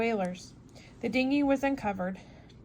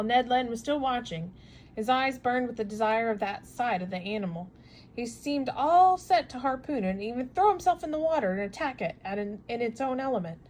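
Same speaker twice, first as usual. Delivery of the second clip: ordinary delivery, nothing marked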